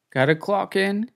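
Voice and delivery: put-on Australian accent. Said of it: "Trying to do Australian didn't work".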